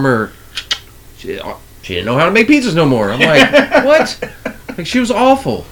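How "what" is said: high, incredulous what; a sharp, high mock disbelief. Retelling Ginger's bad pizzas with a high, incredulous what.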